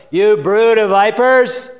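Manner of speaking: booming fire-and-brimstone rebuke; thundering prophet. Acted. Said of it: "John said to the crowds".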